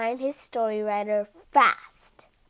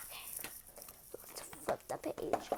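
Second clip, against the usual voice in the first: sneaky whisper; 19 dB quieter than usual. Acted.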